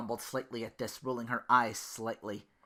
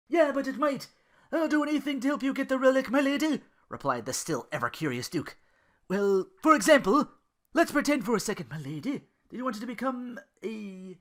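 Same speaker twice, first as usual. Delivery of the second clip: higher, louder, fast earnest; eager Duke; 12 semitones higher, 7 dB louder than usual. Acted. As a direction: rush to offer help.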